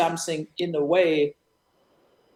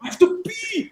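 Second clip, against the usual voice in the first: high, squeaky voice broken by laughter; laughing as the silly guy; laughing mock whine. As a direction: imitate him pleading through giggles. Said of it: "and he's like".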